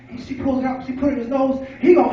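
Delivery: ordinary talk, nothing marked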